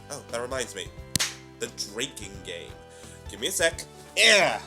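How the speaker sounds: ordinary talk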